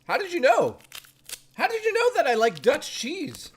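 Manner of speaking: high, playful mock astonishment; mock surprise. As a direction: act amazed at the cheese. Teasing the cheese label.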